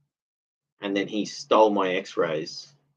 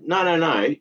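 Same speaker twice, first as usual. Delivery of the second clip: protesting; 7 semitones higher than usual. Retold or imitated. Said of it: "And I said".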